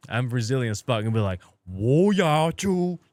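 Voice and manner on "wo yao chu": slow, loud, forceful Chinese phrase, higher; aggressive. Retold or imitated. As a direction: bark out the words slowly. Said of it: "I started getting all Japanese and shit, like, getting aggressive".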